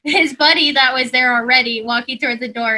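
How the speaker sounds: normal talking voice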